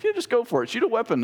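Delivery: a hint of a casual father egging him on; imitating dad. Father urging boy to shoot.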